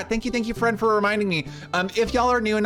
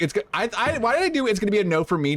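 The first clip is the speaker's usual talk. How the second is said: ordinary delivery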